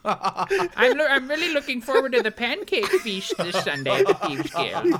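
put-on high, chirpy little old lady; laughing through a dainty granny voice; sweet old lady voice. Voicing the old lady guild leader.

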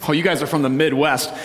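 ordinary talk

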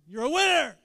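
big, high rallying shout; like you mean it. Shown as a demonstration. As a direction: shout it with full conviction. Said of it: "Say it like you mean it".

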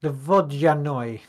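slow, heavy Eastern European accent on The Vodyanoi. Shown as a demonstration. Host reads the cryptid's name in an Eastern European accent. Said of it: "you gotta do it in a Eastern European accent".

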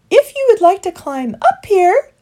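high, loud birdlike call; fluting, high-pitched call; calling dove. A narrator's reading. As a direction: call down from a branch. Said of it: "she called".

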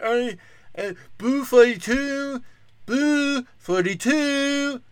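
high, slow, slurred mocking voice; mock deaf guy voice. Comic mocks quarterback calling a play, slurred high voice. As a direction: drag out the calls like a dazed quarterback. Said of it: "a deaf guy voice".

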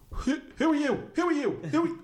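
very high, yappy dog voice; dog's bark as words. Acted. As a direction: yelp the words like an over-excited dog. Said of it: "that's what her bark says to me".

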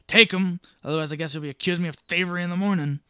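loud angry bark, then fast; raging. Acted. Coach driver dumping bags at traveller's feet, loud angry. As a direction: dump the bags and snap at him.

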